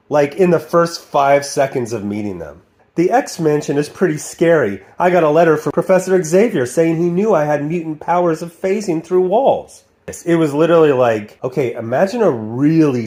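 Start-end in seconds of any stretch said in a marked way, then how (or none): 3.0-9.8 raised-pitch mock cartoon exposition; expository cartoon character. New mutant explains herself on arrival, mock cartoon exposition. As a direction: recite your backstory in a higher voice. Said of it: "everyone has to explain their name".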